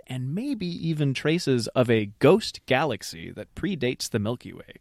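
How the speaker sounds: emphatic, dramatic article read; measured narration with lifted stresses; reading an article aloud. Real, not acted. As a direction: read it out with punchy, drawn-out key words. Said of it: "I'll just read verbatim from this article".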